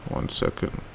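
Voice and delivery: barely voiced, under his breath; muttered aside. Real, not acted. Teacher checking a glitch mid-lesson, whispering to himself. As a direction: mutter to yourself while checking something.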